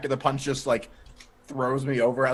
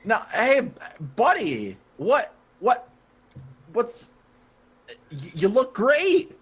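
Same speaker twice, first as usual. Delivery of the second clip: higher, halting, over-friendly reassurance; nervous placating; 6 semitones higher, slower than usual. Acted. Edgar calming angry Weasel, nervously placating. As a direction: stammer your way into a compliment.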